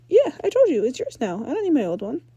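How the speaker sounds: put-on confident girl explaining her gear; Ember's voice. Ember insists.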